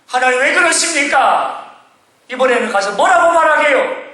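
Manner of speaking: high, plaintive questioning; protesting prophet. Acted. Reluctant Isaiah asking God what to say this time, protesting. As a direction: push back at God with mild exasperation.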